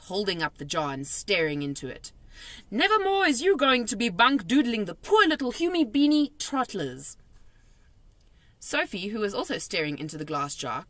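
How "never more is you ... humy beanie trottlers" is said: high, loud scolding threat. A narrator's reading. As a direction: scold the dream. Scolding the trapped dream.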